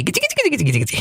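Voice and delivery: very high squeaky gichi-gichi chant of glee; a villain gloats to his wife, squeaky chant of glee; gleeful giggle chant. Acted. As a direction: chirp it like a delighted imp. Villain gloats over his scheme.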